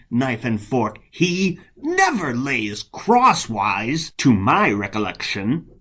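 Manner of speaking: theatrical verse with swooping pitch; jealous monk, self-righteous. Acted. Monk boasts of piety over his rival, swooping indignant scorn.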